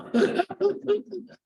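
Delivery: high, loud amused laugh; sudden high delighted laugh; high burst of laughter. Real, not acted. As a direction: laugh hard at the joke. Laughs at his own echo story.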